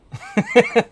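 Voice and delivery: burst of laughter. Real, not acted. Laughing at character hair.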